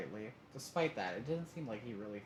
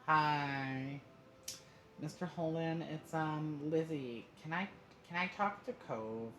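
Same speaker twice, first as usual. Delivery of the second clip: lightly voiced higher, halting child; a hint of a hesitant kid, pitched up; 7 semitones higher, 3 dB louder, slower than usual. A narrator's reading. Child asking for a friend on the phone, hesitant.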